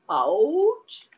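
loud drawn-out dog noise; imitated dog. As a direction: imitate the dog with one rising cry. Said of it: "My dog used to say".

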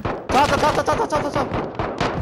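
rapid high repeated callout; excited callout. Real, not acted. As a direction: call it out fast and high. Calling enemy on the roof.

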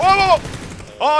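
high, loud repeated wows; excited shout. Real, not acted. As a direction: shriek at the play. Caster reacts to a sudden fight.

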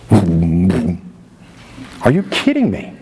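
slow, low, drawn-out disbelief; stunned, dragging the question out. Real, not acted. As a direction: let the disbelief sink in slowly. Preacher reacting to survey numbers.

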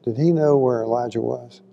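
normal talking voice

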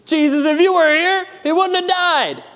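Mary blaming Jesus, voiced high and reproachful; guilt-trip whine. Pastor voicing Mary's complaint to Jesus, high reproachful whine. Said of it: "kinda sounds like a guilt trip".

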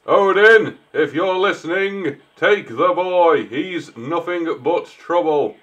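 beseech the god theatrically; mock prayer.

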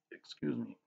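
quiet words with a hiccup. Real, not acted. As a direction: speak through the hiccups. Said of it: "I have the hiccups".

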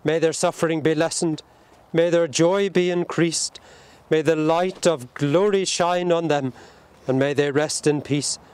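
ordinary talk

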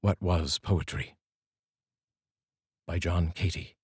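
reading a poem aloud with long pauses between lines. Acted. Reading John Cady's poem for Carl's poetry corner, unhurried poem recital. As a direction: let each line land, then pause before the next.

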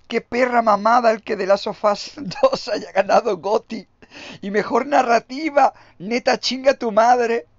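high, loud outraged yelling; furious rant. Real, not acted. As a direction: blow up in disbelief at the award. Rant about The Last of Us winning. (gritando indignado)

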